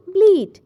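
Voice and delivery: ব্লিট said high and loud, stressed; emphatic word. Shown as a demonstration. Teacher drilling the word ব্লিট, high and loud. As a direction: punch ব্লিট out for the class. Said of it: "B L E A T".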